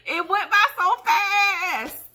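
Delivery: high, loud laugh-cry, slow. Real, not acted. Lamenting how fast school years went, high laugh-crying. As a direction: laugh and wail slowly at the same time. Laughing dismay at time passing.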